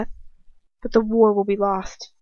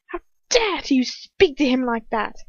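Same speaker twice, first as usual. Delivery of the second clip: higher-pitched outraged rebuke; furious; 5 semitones higher, 3 dB louder than usual. A narrator's reading. Bellatrix to Ron for insulting Lucius, furious. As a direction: lean hard on the outrage. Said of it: "raises her wand furiously".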